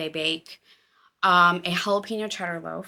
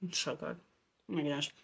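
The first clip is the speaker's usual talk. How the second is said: talking through a mouthful of bread; chewing while talking, words muffled; mouth full; 5 semitones lower, 11 dB quieter, faster than usual. Real, not acted. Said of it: "I shouldn't talk with my mouth full".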